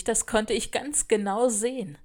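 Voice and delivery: ordinary delivery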